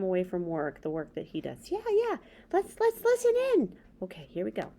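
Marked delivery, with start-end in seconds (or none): 1.7-3.8 high squeaky puppet voice, fast and eager; a chirpy little puppet character. Acted. Puppet Bob's voice again.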